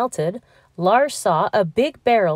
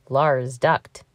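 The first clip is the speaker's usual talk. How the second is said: usual speaking voice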